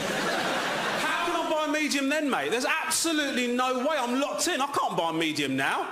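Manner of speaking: shouting in mock panic; mock outrage. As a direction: rage at the popcorn deal, hammed up. Ranting about popcorn.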